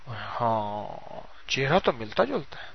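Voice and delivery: very soft, drawn-out musing; hesitant realisation. Acted. Lion cub sees his reflection, softly musing. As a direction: murmur it slowly as it dawns on you.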